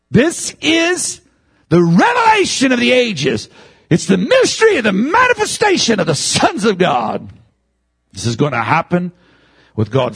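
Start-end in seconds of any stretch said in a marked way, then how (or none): 0.1-7.3 high, emphatic proclamation; full-force preaching. Real, not acted. Preacher announcing the revelation of the ages, proclaimed high. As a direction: herald it to the congregation.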